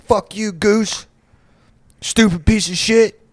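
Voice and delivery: high-pitched mock holler; mock heckling yell. Drunk friend cursing a goose on the lake, high mock yell. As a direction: imitate a drunk friend calling out abuse.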